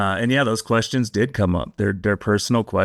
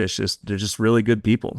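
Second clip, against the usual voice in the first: normal talking voice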